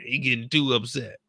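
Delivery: ordinary talk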